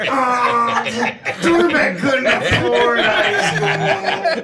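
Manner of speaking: goblin voice. Acted. Player voicing goblin Dorbeck.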